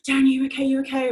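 mildly panicked, imitated questioning; retold panic. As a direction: re-enact a worried, repeated check on a loved one. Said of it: "and extreme panic".

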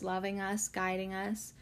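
ordinary delivery

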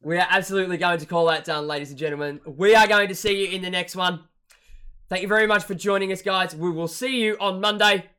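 loud, upbeat host outro; show sign-off. Acted. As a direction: wrap it up like a host. Signing off the show.

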